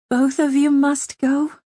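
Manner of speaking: lady pleading with her friends, slow and high. A narrator's reading. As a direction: beg them not to leave, slowly. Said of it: "she asked pleadingly".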